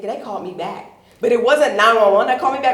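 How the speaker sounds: ordinary delivery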